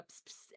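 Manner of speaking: soft unvoiced psst to a cat; cat-calling psst. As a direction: hiss softly to coax a cat. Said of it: "like you do with a cat".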